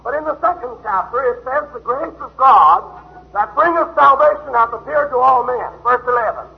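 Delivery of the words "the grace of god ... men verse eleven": a preacher proclaiming a verse; scripture read aloud. Acted. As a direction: lift each word of the verse. Reading scripture with emphasis.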